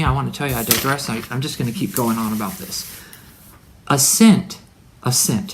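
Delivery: usual speaking voice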